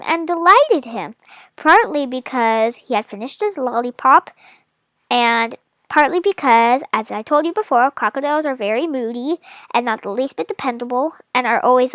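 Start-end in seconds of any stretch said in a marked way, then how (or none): none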